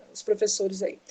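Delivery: a breathy unvoiced aside to the teachers; whispered side remark. Real, not acted.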